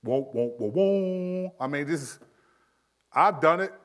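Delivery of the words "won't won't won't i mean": ordinary talk, nothing marked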